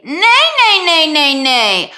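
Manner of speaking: high, loud whining nays; whiny nay. Shown as a demonstration. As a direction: whine the nay into the nose. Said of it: "Now say".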